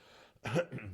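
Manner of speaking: chesty throat clear. Real, not acted. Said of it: "having to clear my throat sometimes".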